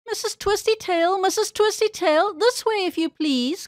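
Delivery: high, repeated hailing call. A narrator's reading. Nurse Jane to the pig bouncing by, calling out. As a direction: hail someone from a way off. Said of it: "called Nurse Jane".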